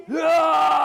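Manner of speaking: high loud burst of a shout; loud high ecstatic cry; joyful shout. Retold or imitated. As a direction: let out a sudden high yell. Jumps up, shouts.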